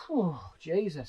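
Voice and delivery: lightly voiced jaded old factory hand, slow; a hint of a worn-out woman sighing; slow tired sigh of a factory veteran; weary factory worker. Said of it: "She sounded like some woman who worked in a factory".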